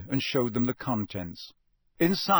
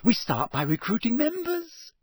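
high-pitched happy announcement; pleased and keen, pitched well up; 11 semitones higher than usual. A narrator's reading. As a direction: answer eagerly. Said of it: "said Hermione happily".

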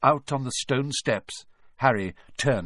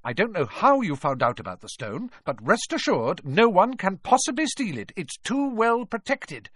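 higher, emphatic assurance, slowing at the end; firm professor; 10 semitones higher, 3 dB louder than usual. A narrator's reading. McGonagall insists nobody can steal the stone, firm. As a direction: close the subject firmly. McGonagall reassures Harry firmly.

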